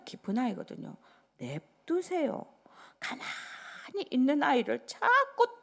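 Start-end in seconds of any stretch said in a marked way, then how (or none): 1.4-4.1 slow, higher let them be; firm gentle plea. Acted. Pastor to parents, slowly coaxing. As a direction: draw it out, coaxing. Telling parents to leave child be.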